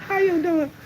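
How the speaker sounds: lightly imitated Southern hello; Southern accent. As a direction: imitate a Texas neighbor's greeting. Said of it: "they still had their little accents".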